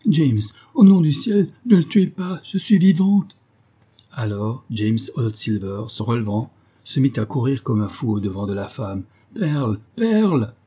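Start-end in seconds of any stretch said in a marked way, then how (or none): 0.1-3.4 high, loud woman's desperate cry; shouting. A narrator's reading. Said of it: "continuant à crier". 9.3-10.5 high cry of her name; overjoyed calling out. A narrator's reading. Man runs wildly toward lost love.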